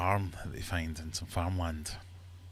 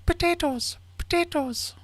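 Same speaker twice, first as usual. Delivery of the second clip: very high, loud mock animal cry; squeaky talking potatoes; 7 dB louder, slower than usual. Irishman in a sack posing as potatoes, high squeal. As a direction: squeal it like a tiny creature. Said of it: "and the Irishman goes".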